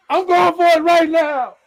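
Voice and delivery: high, strained exclamation; excited outburst. Real, not acted. Fan watching the game live, excited outburst. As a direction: cry it out high over the game.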